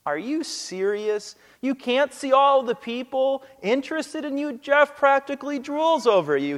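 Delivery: lightly acted incredulous roommate; puzzled, annoyed. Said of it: "puzzled and an annoyed look".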